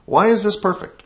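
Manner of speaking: jumps high on the first take; lifted, bright demo of the question; modeling the question. Shown as a demonstration. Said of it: "But today is".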